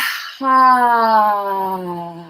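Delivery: loud open-mouthed sigh; long yawning sigh. Shown as a demonstration. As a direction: let out a big yawning sigh. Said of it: "And yawning".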